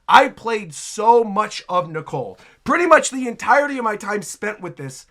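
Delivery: normal talking voice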